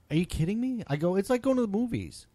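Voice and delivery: high indignant disbelief; incredulous. Retold or imitated. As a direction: argue in outraged disbelief. Arguing with the guy.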